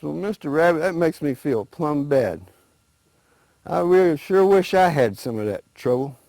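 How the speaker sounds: a naive alligator, pitched high and eager; lightly voiced, wide-eyed alligator asking about trouble. Alligator asking the rabbit what trouble is, high and eager.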